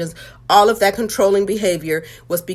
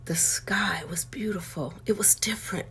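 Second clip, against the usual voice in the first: hushed, emotional whisper; awed whisper; 12 dB quieter than usual. Real, not acted. Awed memory of forgiveness.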